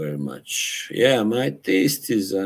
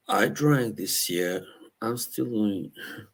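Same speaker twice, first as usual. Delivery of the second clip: Cockney accent impression; imitating a famous actor's Cockney accent; Michael Caine; 2 semitones higher, 5 dB quieter than usual. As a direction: quote it in a put-on Cockney voice. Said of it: "that Cockney accent".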